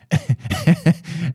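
high breathless giggle burst. Real, not acted. Laughing at the cat story, high giggle. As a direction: giggle helplessly.

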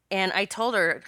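ordinary talk

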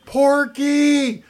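high, panicked scream for help; mom screaming. Retold or imitated. Mom screams at her son during a break-in, panicked high shriek. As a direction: shriek it like a terrified mom in the night. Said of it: "she starts screaming".

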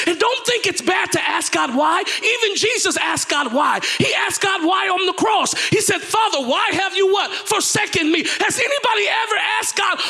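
high, impassioned sermon shout; full-force preaching. Real, not acted. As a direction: drive it home to the congregation, pitched high.

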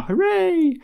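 high, held hooray; cheering. Acted. Email cheer read high.

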